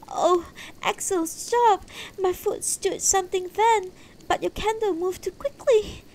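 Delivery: high-pitched, loud cry of alarm from a wife; startled exclamation. A narrator's reading. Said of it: "Beatrice let out an exclamation".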